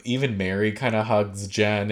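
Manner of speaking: usual speaking voice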